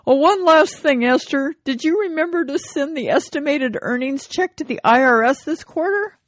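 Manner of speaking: hammed-up worried husband; husband asks. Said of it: "he says".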